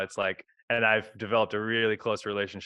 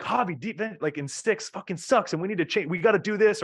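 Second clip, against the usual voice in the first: imitating an angry player, pitched higher; mock aggressive player; 7 semitones higher than usual. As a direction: act out a player venting at the coach, half in jest. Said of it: "being really aggressive".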